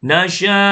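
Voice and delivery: "นะชะ" with air spread through the sh; "นะชะ" chanted with a hissing sh and long vowel; airy sheen sound. Shown as a demonstration. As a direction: blow the breath out on the sh of "นะชะ". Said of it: "ตัวเชน พ่นลม กระจายลมนะครับ".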